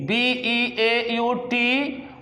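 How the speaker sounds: slow spelling. Shown as a demonstration. Teacher spelling beauty slowly for the class. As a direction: spell each letter very slowly. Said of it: "यहाँ पर लिखा है beauty".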